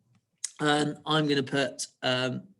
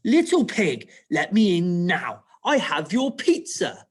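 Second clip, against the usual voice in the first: high, loud cross demand; snapped order at a raised pitch; cross wolf voice; 3 semitones higher, 5 dB louder than usual. Acted. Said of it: "saying it in quite a cross voice".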